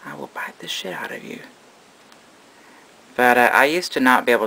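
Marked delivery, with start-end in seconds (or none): 0.0-1.4 playful menacing whisper for the snake; mock snake threat. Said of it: "She said".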